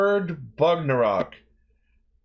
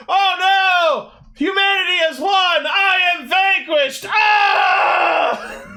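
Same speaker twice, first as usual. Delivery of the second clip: hammy villain defeat cry, much higher and louder; bad acting; 6 dB louder, slower than usual. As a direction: overact a defeated villain, shouting high. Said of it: "that was the worst acting I've ever seen".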